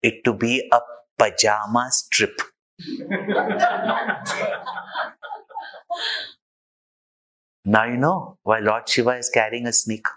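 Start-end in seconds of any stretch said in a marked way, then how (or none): 2.9-5.6 soft airy laugh. Real, not acted. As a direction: chuckle at the punchline. Speaker laughs after punchline.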